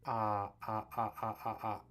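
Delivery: steady repeated "ah" drill; open ah sound. Shown as a demonstration. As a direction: hold an open "ah" at the front, again and again. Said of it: "It's all kinda at the front here".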